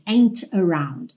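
"ain't around" slowly, T released crisply; clearly articulated T. Shown as a demonstration. Teacher showing careful speech, "ain't around" with clear T. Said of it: "articular o T muito claramente".

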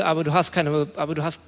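usual speaking voice

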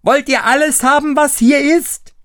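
high, loud yell; loud high shout to wake the house. A narrator's reading. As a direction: yell with all his might. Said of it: "schrie aber gleich aus Leibeskräften".

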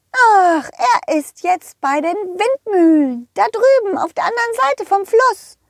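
squeaky high cartoon horse calling out. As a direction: play a cheerful high-pitched pony. Rosa the horse speaks. (hohe quietschige Pferdestimme)

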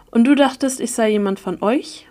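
ordinary delivery

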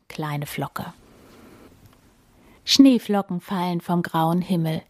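plain reading voice

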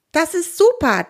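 high, enthusiastic exclamation; delighted cheer. A narrator's reading. Theo claps along to his friend's plan, delighted. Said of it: "Theo klatscht begeistert in seine Grabehändchen".

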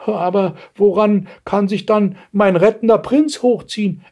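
high-pitched plaintive wail; whining old woman. A narrator's reading. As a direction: whine like an aged damsel. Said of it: "entgegnete die greinende Greisin". (greinende Greisin, hohe Stimme)

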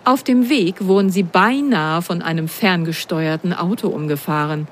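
usual speaking voice

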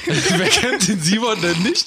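slow, high, laughing repeat; laughing echo. Acted. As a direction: stretch it out while laughing. Echoes, laughing and slower. (langsam und lachend wiederholt)

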